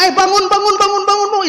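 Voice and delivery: high, loud, urgent calling to wake someone. Retold or imitated. Husband shaking his sleeping wife awake, urgent loud calling. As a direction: rouse a sleeping wife in a hurry.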